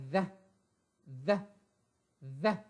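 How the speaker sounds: "the" voiced with the tongue between the teeth; TH sound. Shown as a demonstration. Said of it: "T H pronounce it as".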